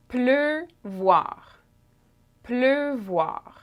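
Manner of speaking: "pleuvoir" drawn out, closed eu then "voir"; French pronunciation demo. Shown as a demonstration. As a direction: model "pleuvoir" twice, lengthening each syllable. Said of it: "And lastly".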